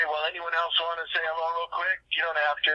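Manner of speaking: ordinary delivery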